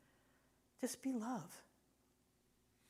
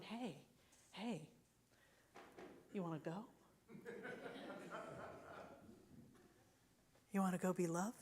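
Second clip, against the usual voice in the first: soft, gentle, inviting call; a hint of a tender God calling; 9 dB quieter than usual. God gently inviting a soul to go be love. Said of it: "I picture God going".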